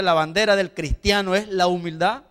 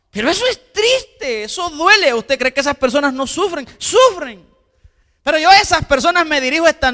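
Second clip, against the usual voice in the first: impassioned preaching; 5 semitones higher, 3 dB louder than usual. Acted. Preacher rises on pain of marriages.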